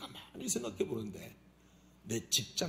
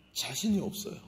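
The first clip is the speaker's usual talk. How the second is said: usual speaking voice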